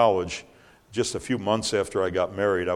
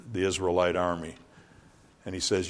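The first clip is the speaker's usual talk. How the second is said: normal talking voice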